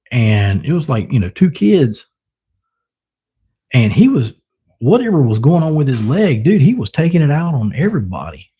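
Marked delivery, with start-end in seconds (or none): none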